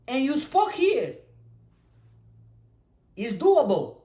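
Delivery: usual speaking voice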